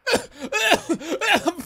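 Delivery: shrieking high laugh; high burst of laughter. Real, not acted. Startled in game, bursts out laughing.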